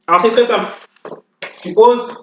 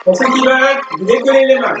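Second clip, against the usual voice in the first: barking gym coach; 5 semitones higher than usual. Acted. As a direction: pump the class up like a fitness coach. Leads workout over music.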